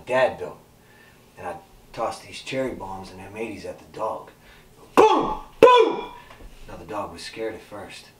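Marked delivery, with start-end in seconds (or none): 4.9-6.1 high, loud shouted booms; explosion noise. Acted. Storyteller imitating cherry bombs going off, loud high booms. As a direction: shout out the blasts.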